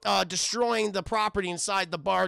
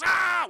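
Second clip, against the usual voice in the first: loud, high mock pain scream; 10 semitones higher, 7 dB louder than usual. Wolverine getting his bone claws crushed, pained scream. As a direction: yell as if bones are breaking. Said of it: "which looks painful".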